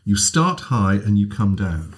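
ordinary talk, nothing marked

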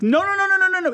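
very high, rapid-fire string of no's; frantic horror-viewer protest. Acted. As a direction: yelp like you're warning someone on screen. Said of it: "I'd be going".

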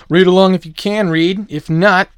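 loud, high show-host call; rally the listeners at full volume. Real, not acted. Loudly calling listeners to read along.

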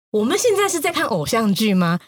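usual speaking voice

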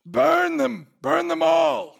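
high, drawn-out war cry; acted battle yell. Player's fighter runs towards the village calling to an ally, yelling. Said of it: "I'm gonna yell".

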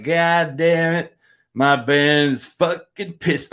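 put-on slow, drawn-out drunk grumble; wasted singer. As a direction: imitate a drunk dragging out his complaint. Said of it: "he's really, really wasted".

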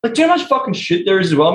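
usual speaking voice

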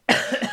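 a short harsh cough; coughing fit. Real, not acted. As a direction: clear the throat with a cough. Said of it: "on that coughing note".

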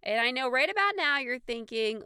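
ordinary talk

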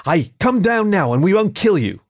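calling out. Acted. Skunk calls up to coax the fox down, calling out. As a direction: call up to someone out of reach.

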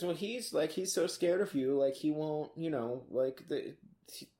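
soft, meek pleading voice; pathetic pleading. Acted. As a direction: shrink away, begging pitifully. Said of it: "acts pathetic".

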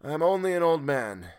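a hint of a strange old man; queer voice. A narrator's reading. Stranger in the boat reassuring the sailor, queer voice. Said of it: "answered the queer voice".

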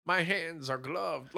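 soft, very slow creep voice; hammed-up slow creep; mock creepy villain. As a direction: draw it out quietly like a creepy villain. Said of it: "He's like".

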